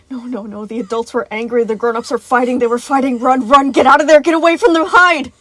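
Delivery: terrified urge to flee; rising panic. A narrator's reading. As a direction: build from anxious to frantic shouting. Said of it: "Alarm flashed through Gregory".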